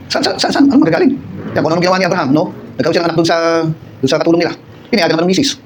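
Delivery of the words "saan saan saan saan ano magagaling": exasperated high complaint. Retold or imitated. Abraham's impatient complaint.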